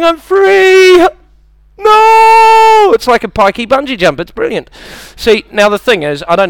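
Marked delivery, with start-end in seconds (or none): 0.0-1.1 high, loud mock kid's cry; gleeful child. Comic plays harnessed kid running off, high gleeful shout. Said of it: "so the kid thinks he's free". 1.8-2.9 very high, loud drawn-out no; mock wail of a yanked-back kid; dismayed child. Comic plays kid reaching harness limit, high dismayed shout.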